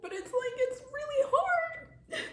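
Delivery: high, laughing through tears; tearful laugh, cracking high; crying laugh. Real, not acted. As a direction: voice breaking into a cry. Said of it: "make a joke about my pain".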